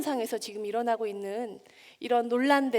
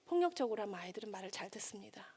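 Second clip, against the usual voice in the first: whisper; 4 semitones lower, 13 dB quieter, faster than usual. Acted. Confiding a bitter truth.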